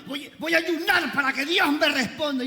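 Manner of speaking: high, strained imitation of a demanding churchgoer; mock insistent believer. Preacher imitating a believer fasting for answers, mock insistence. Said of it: "Si alguno es hermanito y dice".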